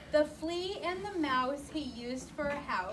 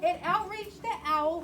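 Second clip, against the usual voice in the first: ordinary delivery, nothing marked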